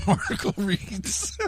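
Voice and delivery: high, laughing stumble on the words; breaking into laughter. Real, not acted. Host trying to resume the article, cracking up. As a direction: crack up while trying to read. Reader cracks up mid-sentence.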